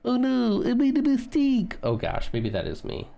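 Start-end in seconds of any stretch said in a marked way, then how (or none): 0.0-1.8 high, loud whiny mock lament; silly voice. Imitating Zeke's silly voice, a high loud whiny oh no. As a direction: wail it like a cartoon character who messed up. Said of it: "He'll go".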